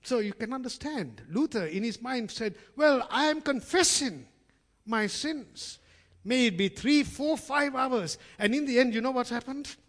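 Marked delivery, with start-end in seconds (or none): 2.8-8.2 high, loud self-justifying monk; Luther's inner voice. Retold or imitated. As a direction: act out Luther insisting he needs hours. Luther voiced in retold scene.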